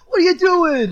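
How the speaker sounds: high, loud mock Boston accent. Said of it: "I can't do a Boston accent".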